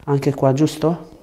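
normal talking voice

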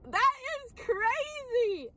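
high, slow amazement; amazed. Real, not acted. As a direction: stretch it out, stunned. Amazed realisation.

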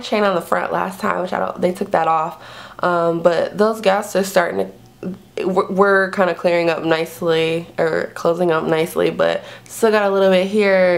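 fingers stretching the lips; talking while showing teeth; speaking with lips pulled back. Real, not acted. Seen 1: Fingers pull lips aside to show braces while she talks.

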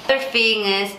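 normal talking voice

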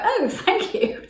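high, slow, flustered thank you; a startled, drawn-out high thanks; thrown thank you. Retold or imitated. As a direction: caught off guard, stretched thank you. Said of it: "Did not know what to say".

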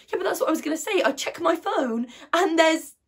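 loud and high, pressing on; agitated outburst. Acted. Girl checking her phone for fallout, agitated and loud. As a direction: push through insistently, building to a held-off reveal.

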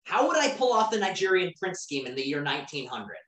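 usual speaking voice

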